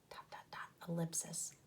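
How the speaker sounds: hushed secret whisper. Shown as a demonstration. Teacher whispers about ellipsis.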